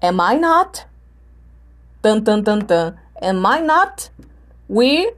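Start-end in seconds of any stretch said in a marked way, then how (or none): 0.0-0.9 "Am I not" climbing steadily to a high end; higher, rising question on "not"; questioning intonation. Shown as a demonstration. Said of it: "Como é que vai ficar uma pergunta com not?". 3.2-4.1 "Am I not" stepping up to a high end; rising model question, "Am I not"; questioning intonation. Shown as a demonstration. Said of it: "uma pergunta com not".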